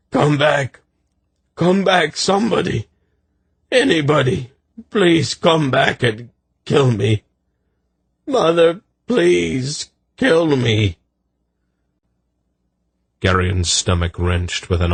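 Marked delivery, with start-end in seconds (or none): 0.1-11.0 high, anguished cries after departing riders; desperate calling out. A narrator's reading. Soldier calling riders back to kill him, desperate. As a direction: call out pleading, stretching the last words. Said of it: "heard him calling after them".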